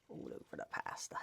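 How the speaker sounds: hushed, whispered echo; playful whisper. Pretend. Vlogger echoing his pouring line to camera, playful whisper. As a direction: whisper it softly, barely voiced.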